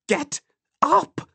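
very high cry, one word at a time; desperate, high-pitched urging; urgent plea. A narrator's reading. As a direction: beg them to move, each word separate. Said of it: "a plea in her eyes".

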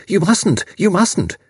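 high-pitched breathless pleading; panting. A narrator's reading. Frantic mother begs Major not to telephone, panting. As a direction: plead urgently, repeating it high. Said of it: "she panted".

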